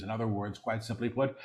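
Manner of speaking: quick, soft undertone; hushed preview. Real, not acted. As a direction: say it softly and rapidly before proclaiming it. Quick soft take before repeating aloud.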